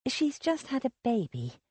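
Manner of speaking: softly murmured defence. A narrator's reading. Lily quietly defending a friend to Sylvia, murmured. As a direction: say it under your breath. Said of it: "Lily murmured".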